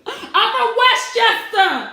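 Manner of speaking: slow, high, loud hammed-up shout; mock Westchester brag. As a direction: yell it out like a proud contestant.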